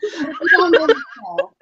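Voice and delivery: giggling through the words; laughing. Real, not acted. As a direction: laugh while introducing her. Laughing mid-introduction.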